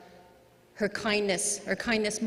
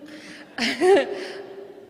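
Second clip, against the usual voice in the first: short embarrassed laugh; 6 semitones higher, 5 dB louder than usual. Real, not acted. Laughs at being asked to speak.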